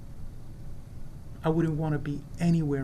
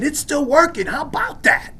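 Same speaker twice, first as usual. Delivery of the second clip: triumphant retort; 8 semitones higher, 7 dB louder than usual. Retold or imitated. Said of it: "I said".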